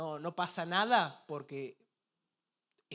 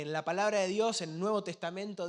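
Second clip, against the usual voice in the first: ordinary delivery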